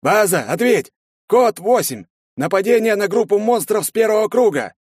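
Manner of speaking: high, loud urgent radio report; торопливый доклад. A narrator's reading. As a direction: call the base urgently, shouting. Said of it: "начал торопливо докладывать". (срочный доклад по рации, криком)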